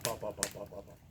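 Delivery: low rapid-fire pops; imitated popping. Popping heard from the tree, imitated low and quick. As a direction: mimic a string of pops in a dropped voice. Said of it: "all of a sudden you hear that".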